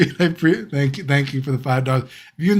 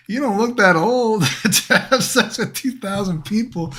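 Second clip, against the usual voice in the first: laughing; 9 semitones higher than usual. Real, not acted. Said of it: "laughing while quoting himself".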